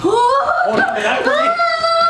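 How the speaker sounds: alarmed high cry. Real, not acted. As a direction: yelp as flames flare. Fire flaring.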